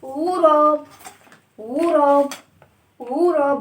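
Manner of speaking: غوريلا called out loudly for the class; teacher calling out غوريلا to class; clear repeat. Shown as a demonstration. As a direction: call out غوريلا for kids to repeat. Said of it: "ها،".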